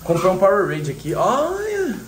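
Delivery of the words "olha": sudden high excited call; excited shout. Real, not acted. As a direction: blurt it out, thrilled. Spots something excitedly. (olha, animado)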